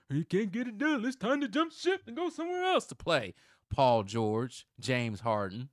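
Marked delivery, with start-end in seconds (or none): 0.1-3.3 high, loud mock voice of quitting players; hammed-up quote, far higher and louder; mocking quitters. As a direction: imitate whiners in a pitched-up put-on voice.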